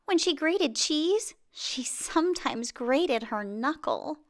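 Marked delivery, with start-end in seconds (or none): none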